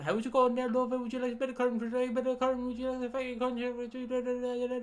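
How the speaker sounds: high, rapid Cork sing-song gibberish; Cork accent. Retold or imitated. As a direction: babble a sales pitch in exaggerated Cork accent. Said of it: "they went up to Cork".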